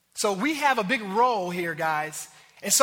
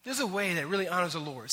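gentle voice of the Lord; 5 semitones lower, 6 dB quieter than usual. Retold or imitated. The Lord answering the quarrelsome wife, soft and gentle. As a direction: lower and soft, calmly correcting. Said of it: "he's just saying".

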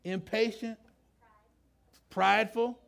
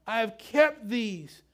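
slow, higher, self-assured; preacher voicing the rich young man proudly; proud claim; 6 semitones higher, 6 dB louder, slower than usual. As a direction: say it like you've kept every rule. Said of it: "What do you hear in his voice?".

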